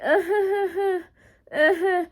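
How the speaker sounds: high, imitated awkward giggle; suppressed nervous laugh. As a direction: act out a scared giggle you're trying to hold in. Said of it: "I'm trying so hard not to laugh".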